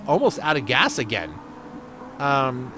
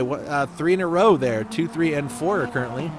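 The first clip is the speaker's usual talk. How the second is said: normal talking voice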